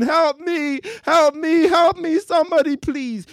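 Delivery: high, loud desperate cries for help; panicked screaming. Acted. Atheist to anyone, screaming in panic for help. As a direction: scream for your life as the bear closes in. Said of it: "the atheist is screaming".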